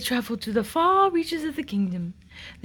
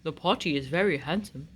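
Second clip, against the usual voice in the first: a proud king praising the potty; 8 semitones lower than usual. Acted. King praising the potty to the prince, low. King voiced in read-aloud story.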